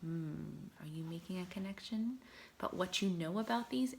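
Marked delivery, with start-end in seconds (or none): none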